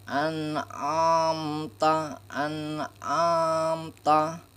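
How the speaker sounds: slow "an amta" with the ain from the throat; correct ain reading. Shown as a demonstration. Teacher showing the class the right ain, slowly. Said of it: "Jadi dibaca".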